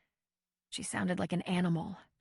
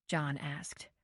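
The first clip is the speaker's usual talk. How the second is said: usual speaking voice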